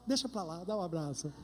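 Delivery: soft tender giving in after the crying; softened forgiveness. Retold or imitated. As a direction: melt and let it go. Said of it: "vi a pessoa chorar e eu falo".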